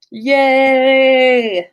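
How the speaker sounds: ordinary delivery, nothing marked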